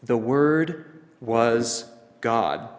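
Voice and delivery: very slow, Word, was and God each standing alone; clear, direct emphasis. Shown as a demonstration. Preacher restates what is meant to shock, slow emphatic God. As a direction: spell it out word by word, landing on God. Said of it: "emphasizes it in a very clear and direct manner".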